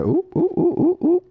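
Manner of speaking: high excited ooh; excited squeals. Real, not acted. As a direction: squeal with delight. Excited card reaction.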